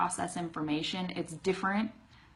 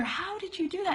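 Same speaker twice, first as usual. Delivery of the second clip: amazed retold question; 11 semitones higher than usual. Retelling her question to friend.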